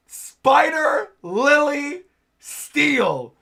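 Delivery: high, loud, drawn-out battle call; attack-name shout. Acted. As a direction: call out your finishing move slowly and loudly.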